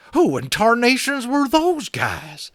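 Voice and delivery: put-on high, loud, slow terrified old cowboy; frightened cowboy. Tiny cowboy shaking with fright asks who attacked, high and loud. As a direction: gibber it out like a scared little cowboy. Cowboy Boone voiced, gibbering.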